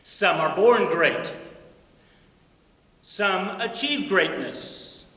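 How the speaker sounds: slow, deliberate recitation, each phrase weighted; solemn recitation. Acted. Teacher quotes Twelfth Night to the honor society, slow and solemn. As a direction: let each line land slowly. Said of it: "he says".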